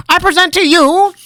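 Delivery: high, loud, grand announcement; grand showman. Acted. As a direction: present it like a ringmaster. Linram's grand flourish.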